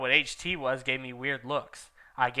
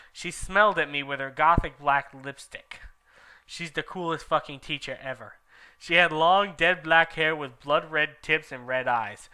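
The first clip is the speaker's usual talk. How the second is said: ordinary delivery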